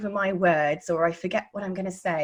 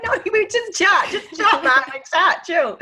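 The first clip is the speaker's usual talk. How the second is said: high, loud, laughing "just chat, chill"; laughing coaxing; 10 semitones higher, 6 dB louder than usual. Retold or imitated. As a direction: laugh the student out of stiffness. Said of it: "I'm like".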